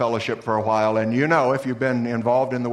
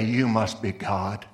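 slow, lightly voiced adoring dog; a hint of a dog gazing up in awe, "You" leaned on; grateful dog; 3 semitones lower, slower than usual. Rescued dog to its owner, slow and adoring. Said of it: "the dog will look at you and say".

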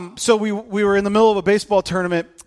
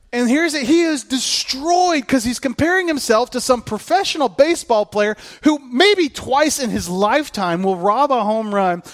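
usual speaking voice

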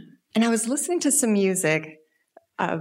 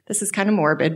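usual speaking voice

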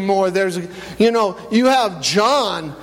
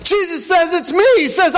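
rising sermon preaching; 6 semitones higher than usual. Acted. Pastor fires up the church about Peter's boldness, preaching with mounting fervor. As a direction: build the sermon up to a passionate peak. Preaching builds to full force.

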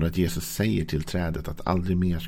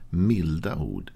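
slow, gentle emphasis; much slower than usual. Shown as a demonstration. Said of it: "Ordspråksbokens författare vad?".

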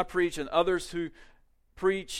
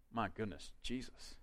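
hushed awe; 6 semitones lower, 15 dB quieter than usual. Acted. Awe at Christ in sermon.

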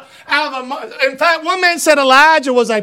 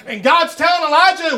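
ordinary talk, nothing marked

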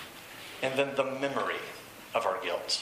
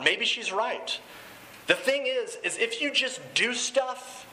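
higher-pitched acted challenge, questions drawn out; retold monologue, raised and insistent; Jesse; 4 semitones higher, slower than usual. Guilty dealer challenging his rehab group, acted challenging monologue. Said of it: "Jesse kind of launches into this monologue".

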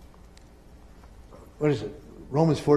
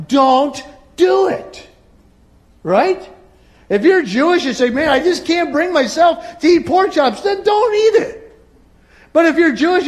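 loud, high preaching, building up; preaching at full force; 7 semitones higher, 7 dB louder than usual. Acted. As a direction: preach it hard to the congregation.

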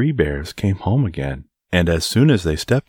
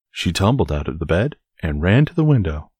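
ordinary delivery, nothing marked

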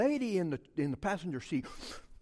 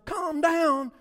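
high, louder command to settle; insistent wife; 11 semitones higher, 7 dB louder than usual. Retold or imitated. Wife ordering her worked-up husband to settle, insistent. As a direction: raise the voice to order calm. Wife's calming turns into order.

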